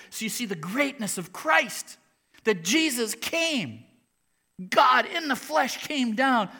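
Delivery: loud, high, urgent sermon voice; fiery preaching. Acted. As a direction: preach it with full force. Preaching at full force.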